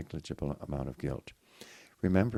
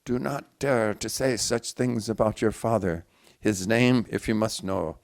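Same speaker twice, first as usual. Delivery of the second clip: louder, sudden forceful outburst; loud vehemence; 4 semitones higher, 8 dB louder than usual. A narrator's reading. Mother defending the father, loud and vehement. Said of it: "pronounce loudly and with sudden vehemence".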